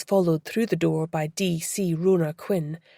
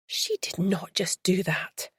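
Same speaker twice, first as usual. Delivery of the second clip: breathy whisper, slow, under the breath; muttered whisper; 5 dB quieter than usual. Acted. Beth watching a tourist pose on the floor, whispered. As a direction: hiss it to yourself in disbelief. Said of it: "muttered Beth".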